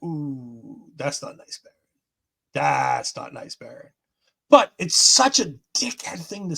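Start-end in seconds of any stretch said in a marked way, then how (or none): 0.0-3.9 low, soft, half-whispered scolding of Baron; mock tut-tut. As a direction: hush and chide him like a disappointed parent. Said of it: "And I was like".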